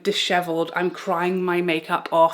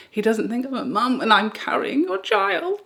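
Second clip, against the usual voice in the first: mock sobbing rant, pitched higher; hysterical pregnant rant; 6 semitones higher than usual. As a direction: replay your own teary outburst, half laughing at it. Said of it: "the crazy person that had been unleashed was saying".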